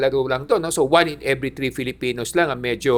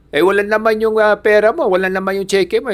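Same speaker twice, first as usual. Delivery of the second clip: retold complaint, raised pitch and louder; angry shopkeeper; 5 semitones higher, 3 dB louder than usual. Shopkeeper complaining to the old man about the empty cheque, mock angry. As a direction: insist the account is empty, mock annoyed. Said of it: "galit na galit yung, ano, yung, ah, tindero".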